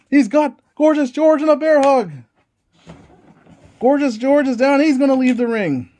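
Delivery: lively ringside play-by-play; wrestling commentator. Acted. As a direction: call the match like a TV announcer. Play-by-play of dice wrestling match.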